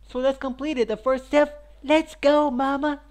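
high, loud pumped-up shout; rally yourself loudly; hyped. Real, not acted. Hyping up the task.

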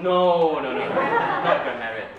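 loud no no no, half laughing; loud laughing refusal of the grammarian role. Retold or imitated. Acts out his own refusal.

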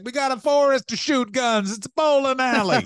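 high, loud hammed-up [name] voice. Mocking [name] defending his bowling alley, loud and high. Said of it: "And he's like".

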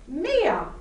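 teacher's sudden exclamation, mildly done; startling call. A narrator's reading. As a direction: call a pupil to attention. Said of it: "startled me".

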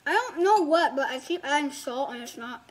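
talking while chewing; mouth full. Real, not acted. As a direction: speak round a mouthful of food. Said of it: "I'm talking with my mouth full".

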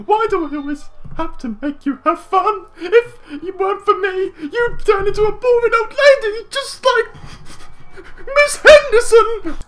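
put-on very high, loud whiny kid; hammed-up squeaky child complaint; little boy crying out. A narrator's reading. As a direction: nag like an exasperated little brother. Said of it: "he cried".

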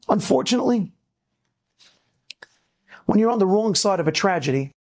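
ordinary talk, nothing marked

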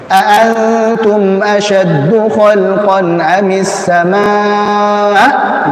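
over-stressed hamzah, slow and heavy; deliberately exaggerated glottal stop; overdone hamzah. Shown as a demonstration. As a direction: hit the hamzah in samaa? too hard. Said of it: "Tapi berlebihan".

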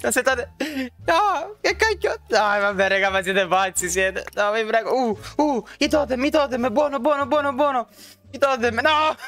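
very high panicked screams; being chased. Real, not acted. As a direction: scream frantically as you get killed. Killed by many players.

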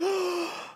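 hammed-up shocked gasp; mock gasp. God pictured as shocked by a mistake, mock gasp. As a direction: gasp in fake horror. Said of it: "He's not gonna be like".

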